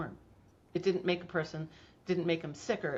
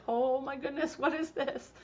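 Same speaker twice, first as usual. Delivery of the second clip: high, wondering gasp of amazement; amazed; 8 semitones higher than usual. Retold or imitated. Mother watching her son's bump vanish, amazed and high. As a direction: marvel at the shrinking bump, pitched up. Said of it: "I was like".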